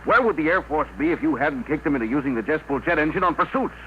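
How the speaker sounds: high-pitched, outraged objection; indignant protest. Acted. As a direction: argue hotly on a friend's behalf. Barnes protests the General's forced retirement.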